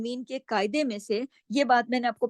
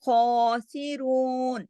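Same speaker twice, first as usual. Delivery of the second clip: slow, drawn-out Quran recitation; chanting the verse as a teacher's model; tajweed recitation; much slower than usual. Shown as a demonstration. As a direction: recite it slowly with careful tajweed. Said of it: "खा मोटा है ना".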